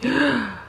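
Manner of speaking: sharp gasp of delight; little high gasp. Real, not acted. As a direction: gasp at the surprise. Gift reveal.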